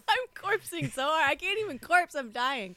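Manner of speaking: laughing through the words; can't stop laughing; cracking up mid-line. Real, not acted. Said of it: "Mike had me laughing so hard".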